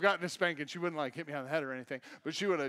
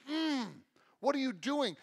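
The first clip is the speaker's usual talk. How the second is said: scolding God; 7 semitones higher than usual. Retold or imitated. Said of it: "God's just gonna be like".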